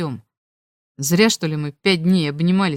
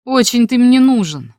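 louder, higher, dismissive retort; scornful rejection; 5 semitones higher, 7 dB louder than usual. A narrator's reading. Marina brushing off the insistent boy, scornful. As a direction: throw it back at him with disdain.

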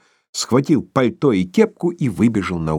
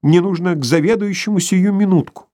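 anxious plea; 8 semitones higher than usual. A narrator's reading. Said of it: "заговорил беспокойно".

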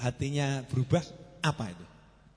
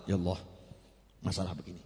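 quiet prayer; 10 semitones lower, 8 dB quieter, faster than usual. Shown as a demonstration. Modelling a calm prayer.

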